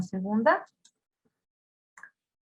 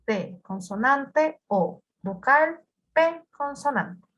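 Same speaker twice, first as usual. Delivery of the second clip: T, consonante spoken deliberately and slowly; patient teacher breaking stop down letter by letter; 3 semitones higher, slower than usual. Shown as a demonstration. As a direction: spell the word out slowly for the class.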